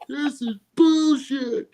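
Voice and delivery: high, drawn-out mock-crying protest; imitated tearful outrage. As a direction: whine it slowly, imitating a fan about to cry. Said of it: "on the verge of tears. He was so pissed".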